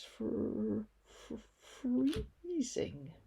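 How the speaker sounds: teeth chattering, words breaking; shivering with cold. Acted. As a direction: stammer through the cold. Freezing wind on the prom.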